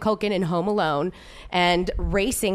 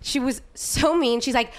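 ordinary talk, nothing marked

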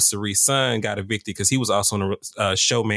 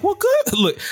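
high, sarcastic "Well, good"; sarcastic approval, pitched way up; 4 dB louder, slower than usual. Retold or imitated. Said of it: "I'm like".

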